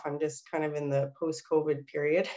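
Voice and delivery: normal talking voice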